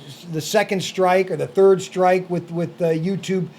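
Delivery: usual speaking voice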